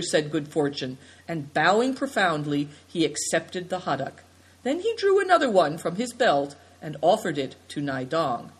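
usual speaking voice

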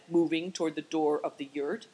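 plain reading voice

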